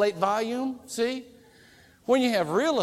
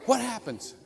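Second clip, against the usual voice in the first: ordinary talk, nothing marked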